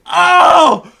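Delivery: high, loud embarrassed "Oh!"; cringing gasp. Retold or imitated. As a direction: yelp of sudden embarrassment. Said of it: "just that cringe at yourself".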